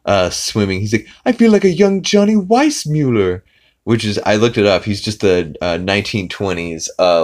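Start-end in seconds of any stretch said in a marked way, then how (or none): 1.2-3.4 much higher, louder pompous voice; proud Stewie. Retold or imitated. Stewie swimming happily, much higher and louder pomp. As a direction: crow with posh delight.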